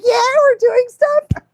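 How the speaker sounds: high, slow, excited dog voice; voicing the dog. Owner voicing her excited dog Yarrow, high and slow. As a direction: speak for the eager dog in a squeaky voice. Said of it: "She's like".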